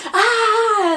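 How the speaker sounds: high, loud comic shriek; hammed-up startled yell; mock shocked scream. Team shocked at Star Destroyer.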